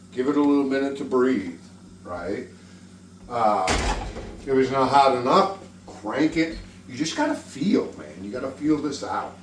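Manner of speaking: ordinary delivery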